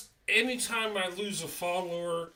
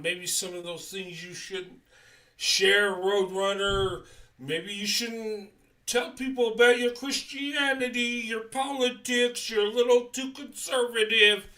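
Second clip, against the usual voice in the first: louder, higher mock scolding from critics; 5 semitones higher, 6 dB louder than usual. Viewers scolding him over his politics, in a loud mocking voice. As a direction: play the naysayers wagging a finger. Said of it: "People say".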